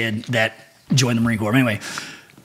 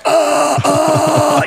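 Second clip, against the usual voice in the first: panicked stammer; 5 dB louder than usual. Retold or imitated. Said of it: "you're just like".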